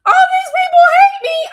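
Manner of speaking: loud, very high-pitched whine; exaggerated high, loud complaint; mock self-pitying wail. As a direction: wail it like a hammed-up victim. Mimics own self-pitying complaint.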